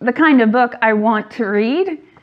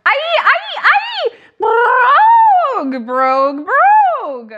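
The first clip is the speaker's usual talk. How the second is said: silly shouting; 13 semitones higher, much slower than usual. Pretend. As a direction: shout the nonsense wildly. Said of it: "make silly sounds like".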